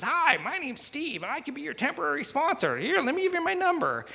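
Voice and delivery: very high, squeaky-friendly impersonation; mock eager sponsor. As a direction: imitate an overeager helper far above your own pitch. Imitating bald man offering temporary sponsorship.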